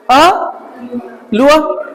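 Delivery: अ alone, pause, then लुआ; syllable breakdown. Shown as a demonstration. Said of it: "अ की sound देता है".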